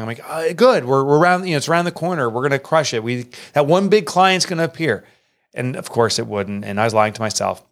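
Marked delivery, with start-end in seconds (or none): 0.3-5.0 higher-pitched, forced optimism; acted-out cover-up, pitched up; falsely upbeat reassurance. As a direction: brush off the worry with hollow confidence. Covering up money trouble to wife.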